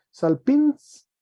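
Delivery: salpins high and stressed on the last syllable; Greek pronunciation. Shown as a demonstration. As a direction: punch the -pins of salpins upward. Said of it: "tiene un acento agudo en la A". (salpins, agudo y alto)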